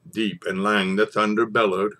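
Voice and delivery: ordinary talk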